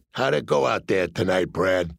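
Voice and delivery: a hint of a low menacing tough guy; lightly voiced cool gangster; mob enforcer voice. Mob man Jilly questions him.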